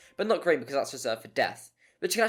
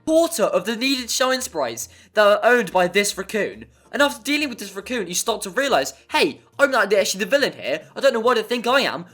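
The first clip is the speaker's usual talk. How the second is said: louder and higher, building to indignant; growing exasperation; 5 semitones higher, 7 dB louder than usual. Acted. Gamer ranting to viewers about the raccoon, building indignation. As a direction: work yourself up into outrage.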